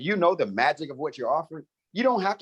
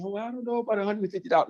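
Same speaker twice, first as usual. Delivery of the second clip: higher-pitched, quick worried objection; mock reluctant customer, pitched up; hesitant prospect; 7 semitones higher, faster than usual. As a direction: imitate a prospect making excuses in a high, hurried voice. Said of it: "you tell me".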